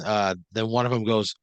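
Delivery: usual speaking voice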